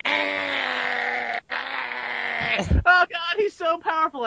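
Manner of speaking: high-pitched drawn-out scream. Retold or imitated. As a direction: scream it out in panicked awe, very high.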